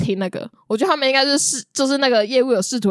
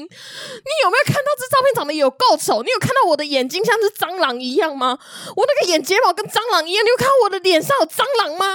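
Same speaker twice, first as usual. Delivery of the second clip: mock furious rant; 8 semitones higher than usual. Retold rant at the salesperson.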